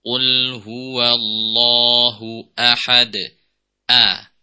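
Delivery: ahad with a firm hard glottal a, slow; strong hamzah. Shown as a demonstration. Teacher demonstrating the strong hamzah in ahad. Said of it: "Jelaskan hamzah pada lafaz ahad".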